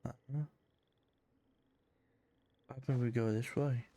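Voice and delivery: ordinary talk, nothing marked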